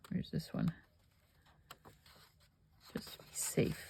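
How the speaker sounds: soft unvoiced whisper. Real, not acted. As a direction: whisper it to yourself. Whispered aside while crafting.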